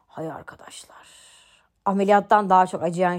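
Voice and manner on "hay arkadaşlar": very soft, almost whispered; hushed sigh. Real, not acted. Vlogger recalling a painful procedure to viewers, hushed sigh. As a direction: breathe it out with barely any voice.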